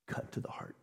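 breathy whisper, almost no voice; preacher whispering the key phrase. Real, not acted. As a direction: let it fall to a whisper. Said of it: "whispered echo of phrase".

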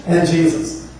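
usual speaking voice

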